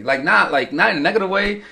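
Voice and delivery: ordinary talk, nothing marked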